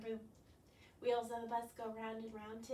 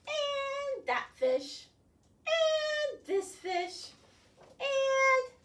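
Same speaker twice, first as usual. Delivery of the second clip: much higher, slow and loud; high drawn-out boast; 12 semitones higher, 8 dB louder, much slower than usual. Acted. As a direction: stretch each fish up high, showing off. Squid boasts, voice rising.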